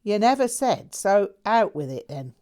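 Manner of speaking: a breezy lad spinning his tale; lightly voiced cheeky Londoner. A narrator's reading. Jack teasing his old friend, in a cheery Londoner voice. Jack's voice.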